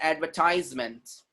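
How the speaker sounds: advertisement as "ad-ver-TIZE-ment"; long eye sound in the middle of advertisement; American pronunciation. Shown as a demonstration. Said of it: "is American sound".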